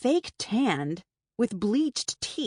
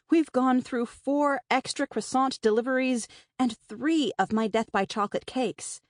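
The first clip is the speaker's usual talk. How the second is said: ordinary delivery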